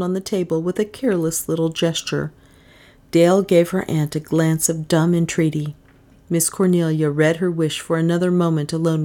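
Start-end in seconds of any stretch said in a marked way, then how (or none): none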